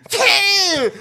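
high, drawn-out mock sneeze; imitated sneeze. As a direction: act out the kid's sneeze. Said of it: "this little shit fucking sneezed on me".

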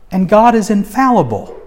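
ordinary talk, nothing marked

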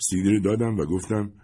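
ordinary delivery

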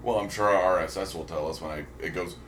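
ordinary talk

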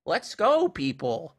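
high, loud mom impression; imitated mom rallying. Imitating his mom's hype voice memo, rallying mom. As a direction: call it out high, then drop on the last word. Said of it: "it's, like, his mom going".

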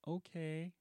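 high, timid little okay; shy okay. Retold or imitated. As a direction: say it shyly, barely agreeing. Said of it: "shyly is like".

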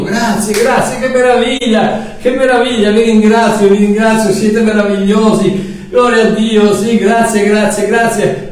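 high, delighted thank-yous; mock-overjoyed Mario thanking everyone; gushing thanks. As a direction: overflow with gratitude.

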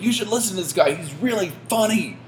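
high-pitched mock holler to passers-by; imitated yell. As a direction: play an excited fan calling out. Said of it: "he literally yells".

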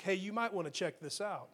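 mock-casual throwaway suggestion; casual offhand suggestion. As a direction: shrug it off as a mere tip. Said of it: "just a suggestion".

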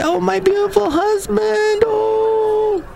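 very high swooning mock coo; mock a wife cooing over her husband online; gushing wife. Mocking a wife's post about her husband, high swooning coo.

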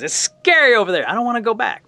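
high-pitched small-creature whine; scared little raccoon. Acted. Little raccoon refusing to go back, scared. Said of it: "the little raccoon says".

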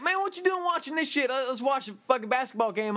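high, cocky drop-in buddy; brash friend voice. Retold or imitated. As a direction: swagger in like you own the couch.

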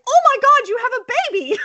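very high, loud squeal; over-the-top high-pitched shock; mock astonishment. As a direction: squeal like an amazed teacher meeting a baby. Acts teacher's astonishment.

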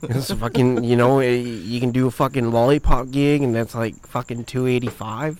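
rambling broad Aussie tradie; Australian accent. Shown as a demonstration. Tradie explaining cash jobs, broad mock Australian. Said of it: "do the accent right for an Australian".

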